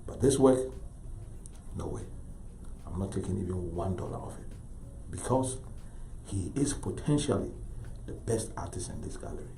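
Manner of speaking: soft, measured sincerity with long pauses; quietly firm, heartfelt appeal; speaking from the heart. Retold or imitated. Gallery dealer to senator, championing his artist, soft and sincere. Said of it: "I saw Leroy speaking his heart".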